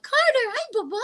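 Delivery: high squeaky pet voice, far above her own; gushing high-pitched hello to Bubba. Real, not acted. Greeting her dog in the room.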